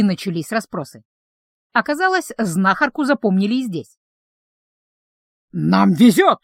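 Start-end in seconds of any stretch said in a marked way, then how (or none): 5.5-6.4 louder, boastful announcement; proud proclamation. A narrator's reading. Troll boasting of the group's luck, proudly proclaiming. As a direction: declare it with pride. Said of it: "гордо ... провозгласил тролль".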